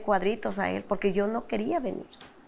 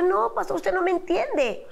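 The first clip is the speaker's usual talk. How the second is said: high, protesting objection; retold arguing; 10 semitones higher than usual. Wife arguing with the pastor about husband, high and exasperated. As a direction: push back heatedly in a high voice. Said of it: "Y yo peleaba con el pastor".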